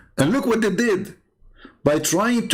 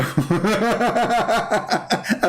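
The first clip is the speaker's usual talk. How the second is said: high rapid cackling; real laughter; 6 semitones higher than usual. Real, not acted. Streamer amused by a caller's jibe, high laughter. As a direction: burst into high, helpless laughter.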